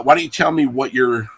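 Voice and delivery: usual speaking voice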